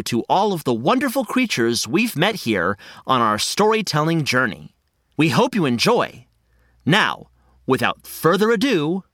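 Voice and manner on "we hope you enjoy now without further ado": normal talking voice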